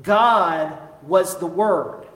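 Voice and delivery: slow "God was the Word" with weight on "God"; emphatic. Shown as a demonstration. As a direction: hammer home "God was the Word", slowly. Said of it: "could not have said it more emphatically".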